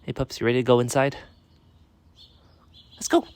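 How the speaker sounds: brisk call to a pup; calling the dog. Real, not acted. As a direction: chivvy the dog along, loud and quick. Talking to the dog.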